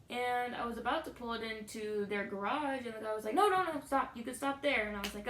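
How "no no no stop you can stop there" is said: louder, higher urgent call to halt; calling out stop. Retold or imitated. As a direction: wave the driver down with your voice. Tire guy calling her to stop.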